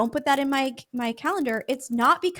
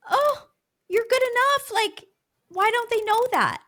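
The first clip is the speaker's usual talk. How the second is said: much higher, pleading reaction; high-pitched sympathetic protest; dismayed reassurance; 11 semitones higher, 3 dB louder than usual. Retold or imitated. Reacting to someone who wants to be better, dismayed and high. Voicing judgment on hearing another's goal.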